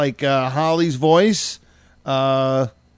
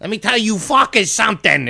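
higher-pitched mock Boston accent; 7 semitones higher, 3 dB louder than usual. Host mimicking Marianne when it comes out, mock Boston accent. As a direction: imitate a woman's Boston accent slipping out, pushed up high. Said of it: "as the Boston accent".

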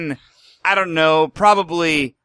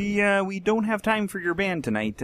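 Kermit-ish voice; 5 semitones higher than usual. Pretend. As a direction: let Scooter down gently as Kermit. Kermit-like voice rejecting Scooter.

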